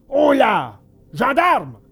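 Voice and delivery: commanding hail to underlings; calling out. A narrator's reading. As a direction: call the guards over. Puppet master summons his guards.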